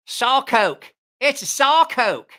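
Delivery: loud, high southeast Ohio twang; twangy American accent. Pretend. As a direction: call it out in a broad Ohio twang. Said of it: "that's no accent".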